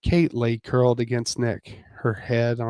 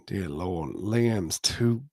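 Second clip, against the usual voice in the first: groaning; 5 semitones lower, slower than usual. Acted. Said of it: "Nick groaned".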